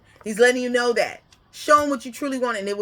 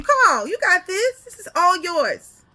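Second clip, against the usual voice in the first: much higher and louder, cheering; encouraging father; 10 semitones higher, 5 dB louder than usual. Retold or imitated. Father cheering his child on, high and loud. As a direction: pump the kid up. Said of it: "Just encouraging you".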